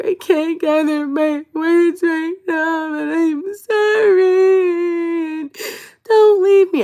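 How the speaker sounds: high, very drawn-out wail; tearful begging. Retold or imitated. Retelling how she begged him to stay, wailing. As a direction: plead in a loud, hammed-up crying voice. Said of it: "I was begging".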